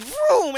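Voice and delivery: high zooming vroom; mock engine vroom. Filly acting out the chase, playful vroom. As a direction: zoom it like a racing engine. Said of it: "Applejack was all like".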